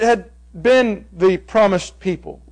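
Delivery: usual speaking voice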